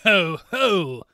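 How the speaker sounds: loud scornful ho-ho; mocking laugh. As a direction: laugh it out at her. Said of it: "cried the stranger with a laugh".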